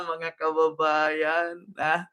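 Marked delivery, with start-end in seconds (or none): none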